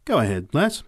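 lightly voiced hearty encouragement; encouraging heckler. A narrator's reading. Big woman cheering the preacher on.

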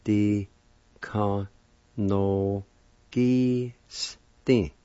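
"di ka no gi sti" split into evenly spaced syllables. Shown as a demonstration. Teacher breaks down second part for learners, syllable by syllable. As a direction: sound out "di ka no gi sti" slowly, pausing between parts. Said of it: "Second part".

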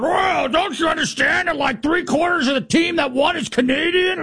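shrill mocking impression of an offended Canadian fan; whiny indignant Canadian. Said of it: "they come back out".

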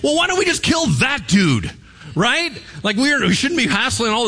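a fed-up ruler blurting it out; annoyed king deciding to get rid of the prophet; Syrian king. Retold or imitated. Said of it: "And he's like".

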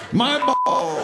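high, drawn-out cry of pain; mock agonized wail. Cadet to passing instructor under the chute, mock agony.

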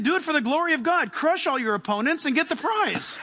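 ordinary delivery

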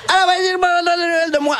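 very high, slow, nasal and screechy; mock his own grating TV voice; whiny nasal voice. A friend hears [name]'s grating nasal TV voice. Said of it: "la petite voix nasillarde, fatigante, criarde".

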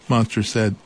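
usual speaking voice

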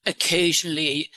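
put-on disguise the voice with an English accent. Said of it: "Where did you get your English accent?".

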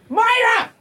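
high yelled name; calling out. Retold or imitated. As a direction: call her name across the street. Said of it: "you just start going".

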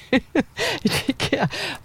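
high giggle at her own slip; giggling at a botched tongue twister; embarrassed laugh. Real, not acted. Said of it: "how embarrassing that was".

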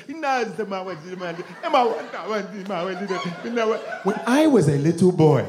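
mock African accent, murmured foreign-sounding babble; desperate African interviewee. African talking under an interpreter, desperate mock African accent. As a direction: plead in a put-on African accent. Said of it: "they always look desperate".

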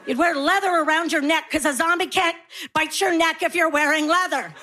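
ordinary talk

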